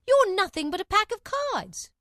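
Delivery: high, loud denunciation; defiant outburst. A narrator's reading. Alice denounces the whole court, loud defiant outburst. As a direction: denounce them all at high pitch, loudly.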